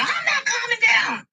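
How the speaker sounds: high, slow shout of refusal; defiant yell. Retold or imitated.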